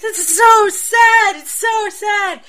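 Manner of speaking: high, loud, slow fake sobbing wail; mock bawling. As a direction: wail it like an overdramatic teen at a sad movie.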